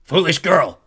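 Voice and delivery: war god's loud contempt; angry bellow. A narrator's reading. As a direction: shout it down at a cowering girl. War god berates Clarice.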